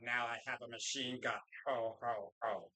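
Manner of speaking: low, deadpan movie-villain line; movie villain quote. Acted. Quotes Die Hard villain line.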